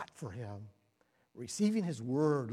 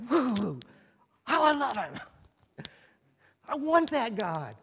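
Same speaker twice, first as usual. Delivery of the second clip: hammed-up ecstasy; 8 semitones higher, 10 dB louder than usual. Mocking ecstatic worshipper.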